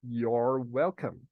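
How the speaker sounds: drawn out for the class to repeat; slow model phrase. Shown as a demonstration. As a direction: say it slowly so they can copy. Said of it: "Repita comigo".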